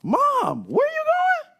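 put-on high, loud little-kid voice; a small boy calling after his mother; child calling mom. Little boy calling after his leaving mom, high and pleading.

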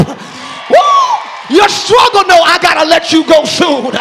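loud, high preaching at full force. Real, not acted. Preacher rousing his congregation to praise, loud and high. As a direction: shout it to the back pews as the climax builds.

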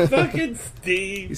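slow words through laughter; laughing. Real, not acted. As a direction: laugh it out slowly. Repeats the joke through laughter.

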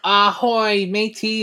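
light pirate greeting; a hint of pirate. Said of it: "they give him a pirate voice".

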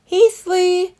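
high, loud call of a name; cried out. Acted. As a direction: call across to your friend. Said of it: "cried Christopher".

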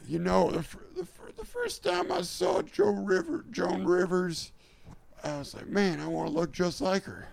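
high, halting put-on politician; Joe Biden. Politician confessing admiration for Joan Rivers, high halting impression. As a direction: reminisce in a high mock voice. Continues the Biden coming-out bit.

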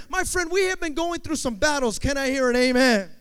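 loud, high call to the congregation; preacher's amen call. Real, not acted. Preacher asking the church for an amen, loud call. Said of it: "I'm gonna ask you one more time".

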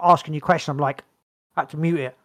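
normal talking voice

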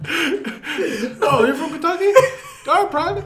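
high, loud barked orders, half laughing; mock drill sergeant shouting; drill instructor. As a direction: bark commands at a recruit. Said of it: "if you're a D I".

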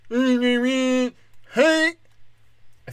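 high, loud, slurred mock voice; mock deaf guy voice. Comic mocks quarterback at the line, slurred high voice. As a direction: blurt it out slow like the dazed quarterback.

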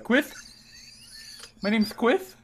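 "Quith" said with a lisp, th for s, high and puzzled. Retold or imitated. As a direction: lisp on "Quith", tongue between teeth. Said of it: "he had a lisp like this".

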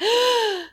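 high startled mock gasp; sharp gasp. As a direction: gasp in sudden shock. Dawn notices vamp face.

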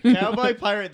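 loud, high delighted shout; excited shout. Real, not acted. Excited outburst.

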